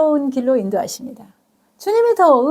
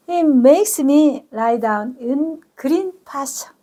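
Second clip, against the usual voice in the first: slow verse reading; much slower than usual. Shown as a demonstration. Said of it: "주님은 나를 눕게 하시는데".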